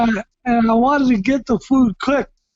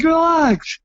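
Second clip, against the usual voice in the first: higher, stretched-out retold plea; lightly hammed-up plea to chill; mock exasperated relax; 4 semitones higher than usual. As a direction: draw it out to calm a worrier. Ends retold plea to nervous wife.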